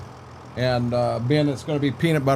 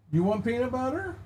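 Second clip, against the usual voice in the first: higher-pitched, softer question to a dog; high dog talk; 9 semitones higher, 7 dB quieter than usual. Real, not acted. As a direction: lift up into sing-song dog talk. Asking the dog.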